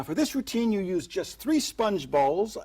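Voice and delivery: ordinary talk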